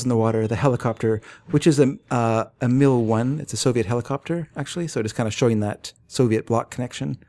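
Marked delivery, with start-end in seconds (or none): none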